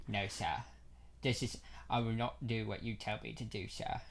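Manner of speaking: a hushed take on Jaden as Caine; Michael Caine. Retold or imitated. Cohost redoes Jaden's line as Michael Caine, low and soft. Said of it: "I say Michael Caine".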